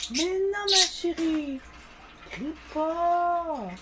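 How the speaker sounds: high, soft cooing to hush the pet; pet talk. Real, not acted. Hushing her crying pet, high soft pet voice.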